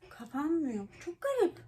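high, puzzled squeal of surprise; surprised squeak. Real, not acted. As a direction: squeak out your confusion. Lipstick cap won't close.

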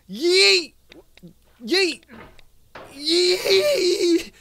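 high cries breaking into laughter; high, losing-it whoops; frantic yells. Real, not acted. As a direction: yell until it cracks into a helpless laugh. Repeated launch cries breaking into laughter.

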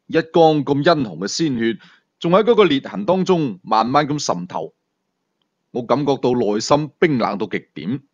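plain reading voice

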